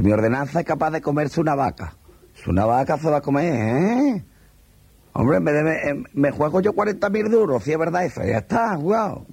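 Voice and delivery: a boastful officer egging on his rival, pitch climbing; excited bragging captain. Acted. Captain raising the bet against another captain, excited boast.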